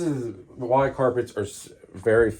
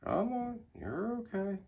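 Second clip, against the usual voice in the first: high, soft coaxing; coaxing pet voice; 12 semitones higher, 9 dB quieter than usual. Real, not acted. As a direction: sweet-talk a shy animal out. Coaxing a snake out of cage.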